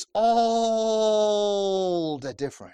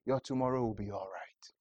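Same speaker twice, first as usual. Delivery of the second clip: whisper; 10 semitones lower, 12 dB quieter than usual. Acted. Preacher drops to whisper.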